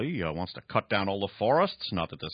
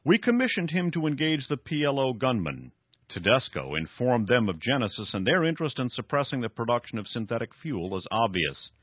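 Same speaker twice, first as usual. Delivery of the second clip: raised, emphatic story reading; dramatic novel reading; 6 semitones higher, 3 dB louder than usual. Acted. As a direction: read the villain's boast with drama. Reads novel passage aloud.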